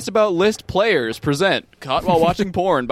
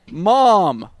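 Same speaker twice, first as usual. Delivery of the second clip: calling out; 6 semitones higher, 3 dB louder than usual. Acted. Child calling out in script.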